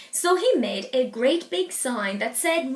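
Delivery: ordinary delivery, nothing marked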